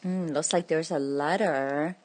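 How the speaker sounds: ordinary delivery, nothing marked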